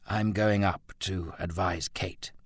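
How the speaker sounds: measured, heavy delivery; slowly. Acted. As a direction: take your time, weighing each word. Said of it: "he said slowly".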